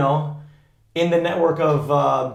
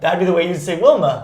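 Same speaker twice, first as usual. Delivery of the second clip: Wilma said Boston-style as Wilmer, r-less ending; Boston accent; 3 semitones higher, 4 dB louder than usual. Shown as a demonstration. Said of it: "with a Boston accent".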